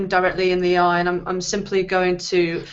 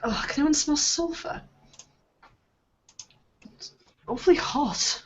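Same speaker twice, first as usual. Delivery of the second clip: high, airy complaining character; fussy in-character voice; 8 semitones higher, 5 dB quieter than usual. Acted. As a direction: fret about the heat in a put-on voice. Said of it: "That's what bothers you, [name]".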